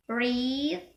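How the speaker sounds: "read" with a long, stretched E. Shown as a demonstration. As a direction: hold the vowel of "read" long. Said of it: "for the long E is".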